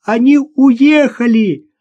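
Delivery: high, slow, loud wail; tearful cry. A narrator's reading. Sister tells her brother they have left, crying out tearfully. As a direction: shout it out as if close to tears. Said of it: "закричала со слезами в голосе Наташа".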